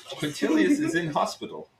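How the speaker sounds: put-on joking in a Russian innkeeper's accent. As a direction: play it as a Russian bar owner. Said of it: "it's Russian".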